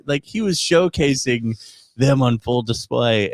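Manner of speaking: ordinary talk, nothing marked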